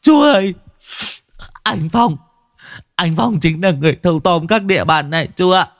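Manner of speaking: high, whimpering near-tears plea; snivelling. Acted. Boy Tùng snivelling to Vũ, high whimper. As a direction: whine it out on the verge of crying. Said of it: "vẫn mếu máo".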